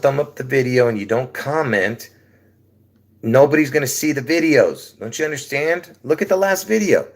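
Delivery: worked up about a failed video; exasperated complaint. Real, not acted. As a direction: plead with the viewers who ignore you. Scolding viewers over few views.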